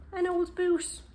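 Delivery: high, loud and surprised; surprised reveal. A narrator's reading. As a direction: announce the find with a gasp of wonder. Reading the boot discovery.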